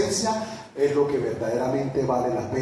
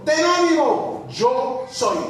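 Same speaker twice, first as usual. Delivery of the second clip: much higher and louder, slow cry of Jesus' words; proclaiming Jesus; 12 semitones higher, 6 dB louder, much slower than usual. Acted. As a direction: cry out the divine comfort. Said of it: "Él le dijo". (Jesús a los discípulos en el agua, proclamado muy agudo y fuerte)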